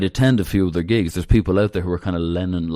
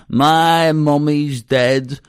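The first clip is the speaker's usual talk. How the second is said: drawn-out, louder and higher mock wail; mock scream into the mic; 6 semitones higher, 5 dB louder, much slower than usual. Imitating John screaming his grief into the microphone, slow mock wail. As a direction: stretch it out like a pained rock cry. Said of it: "scream into the microphone saying".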